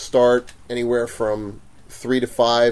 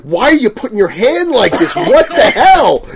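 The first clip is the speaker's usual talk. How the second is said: high, loud, half-laughing retort; incredulous outburst; 9 semitones higher, 6 dB louder than usual. Retold or imitated. Vendor reacting to the customer's hand, incredulous outburst. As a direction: burst out in disbelief, playing it for laughs.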